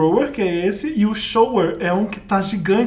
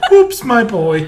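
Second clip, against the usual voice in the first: put-on British accent; 2 semitones higher, 6 dB louder, much slower than usual. Mock English exclamation.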